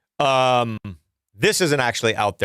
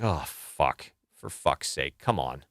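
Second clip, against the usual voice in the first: exasperated groan; 9 semitones lower, 7 dB quieter, slower than usual. Retold or imitated. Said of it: "I was like".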